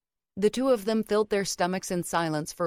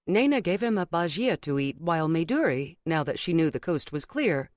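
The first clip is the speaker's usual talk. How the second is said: usual speaking voice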